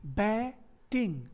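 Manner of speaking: बैटिंग split slowly into बै and टिंग; syllable by syllable. Shown as a demonstration. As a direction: break बैटिंग before the ट. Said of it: "तो ए और ई के बीच में".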